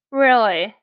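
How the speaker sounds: dry, sarcastic "really". Acted. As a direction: say it with heavy sarcasm. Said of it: "the blonde spoke sarcastically".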